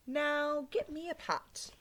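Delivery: slow, lightly voiced command; a hint of a bossy stranger; young man ordering the lady.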